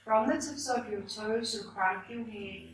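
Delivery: soft, unhurried yoga relaxation voice; guided meditation. Real, not acted.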